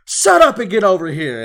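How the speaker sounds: high, very loud mock-angry shout; Bakugo. Hero who has to save civilians, imitated bossy yell. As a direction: yell the order roughly at bystanders, imitating. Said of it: "And he's all like".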